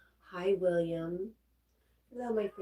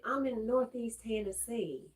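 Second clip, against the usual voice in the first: slow, higher-pitched Southern drawl; attempted Southern accent; 6 semitones higher, slower than usual. Shown as a demonstration. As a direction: stretch the vowels in a put-on Southern accent. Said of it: "I cannot do these Southern accents".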